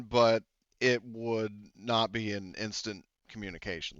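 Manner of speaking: ordinary talk, nothing marked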